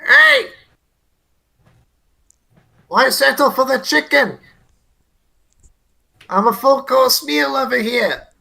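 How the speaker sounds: high-pitched shout to lure a beast; taunting shout. Acted. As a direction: call out high, goading it to come at you. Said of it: "and shout".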